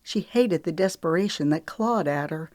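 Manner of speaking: ordinary delivery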